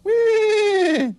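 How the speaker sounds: very high, loud whinnying squeal; long, high-pitched neigh; horse whinny. Shown as a demonstration. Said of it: "And a horse goes".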